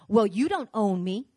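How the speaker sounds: loud, indignant back talk; mock attitude. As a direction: play a defiant church member. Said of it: "with that kind of attitude".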